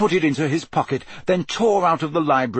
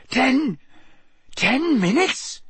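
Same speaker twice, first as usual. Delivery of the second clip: much higher, drawn-out shocked question; croaked disbelief; 11 semitones higher, slower than usual. A narrator's reading. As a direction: repeat it in rising alarm. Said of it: "Harry croaked".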